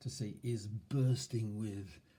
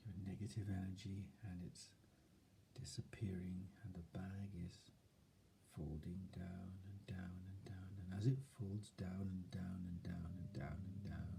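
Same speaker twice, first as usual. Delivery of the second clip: softer, hushed, half-whispered calm; soft soothing voice; 6 dB quieter than usual. Shown as a demonstration. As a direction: soothe yourself like a child. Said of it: "See that tone of voice?".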